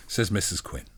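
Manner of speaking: ordinary delivery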